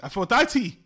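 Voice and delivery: Aphrodite exclaimed high and loud, ending "dye-tee"; correcting pronunciation. Shown as a demonstration. Co-host correcting his partner, Aphrodite loudly ending "dye-tee". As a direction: loudly hit every syllable of Aphrodite, final e sounded. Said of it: "Get the pronunciation right".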